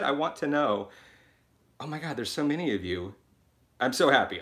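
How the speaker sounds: usual speaking voice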